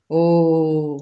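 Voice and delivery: loud, drawn-out „Ó”; cheeky yell. A narrator's reading. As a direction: shout a long, impudent oh. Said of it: "rikoltotta hirtelen egy ismerős, pimasz hang". (pimasz, elnyújtott rikoltás)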